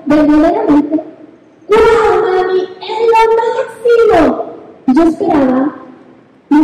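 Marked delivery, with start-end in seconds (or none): 1.7-4.4 put-on higher, slow, gushing child's delight; lightly voiced little girl squealing with joy; excited daughter. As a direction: play the thrilled girl calling to mom. Daughter excited after the slide.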